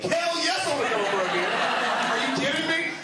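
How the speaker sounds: very high, loud, gleeful shout; thrilled yell. Retold or imitated. Accepting a drunk stranger's beer invite, high gleeful shout. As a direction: explode with delight at the offer.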